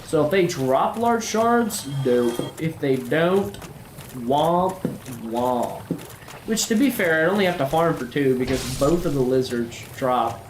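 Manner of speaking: a country-boy Southern accent played for laughs; put-on Southern accent. Gamer chatting to viewers in a mild mock Southern accent. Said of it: "I feel like I should be offended by this accent".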